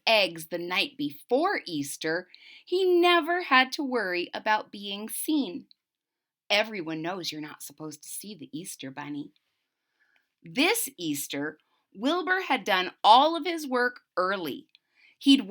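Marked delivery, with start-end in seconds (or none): none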